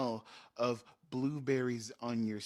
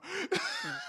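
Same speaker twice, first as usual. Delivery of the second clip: a sudden high laugh bursting out; high burst of laughter. Real, not acted. The speaker laughs at the players' panic, a high laugh.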